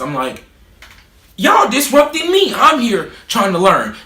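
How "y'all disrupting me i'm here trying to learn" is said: high, loud and slow, thrown back at the teacher; indignant outburst. Retold or imitated. Snapping back at the teacher in class, indignant and loud. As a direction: talk back hard like the room is yours.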